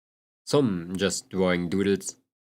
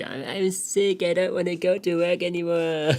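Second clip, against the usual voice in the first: whiny mock voice; 8 semitones higher than usual. Mocking his present self to chat, higher and whiny. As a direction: whine like a kid who won't go. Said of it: "there's like".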